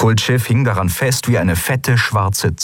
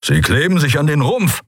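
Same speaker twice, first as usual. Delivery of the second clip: higher, urgent warning; tense, agitated report; growling warning; 7 semitones higher than usual. A narrator's reading. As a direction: raise the pitch as the alarm grows. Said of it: "knurrte Keru".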